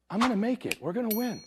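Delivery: put-on high, soft little-boy voice; son's voice. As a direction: voice a child quietly reassuring his dad. Son's confident reply, retold.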